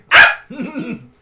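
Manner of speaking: sudden loud, high yelp; pawn's scream. Acted. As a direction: scream out as the frightened pawn. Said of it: "You hear the pawn just scream".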